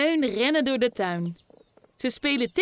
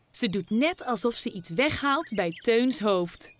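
usual speaking voice

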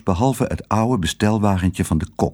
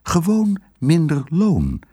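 firm wife; 7 semitones higher than usual. A narrator's reading. Wife to husband, firm and decisive. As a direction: settle it and send him off.

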